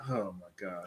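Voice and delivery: groggy just-woke-up voice. Pretend. Mock sleepy voice in robe.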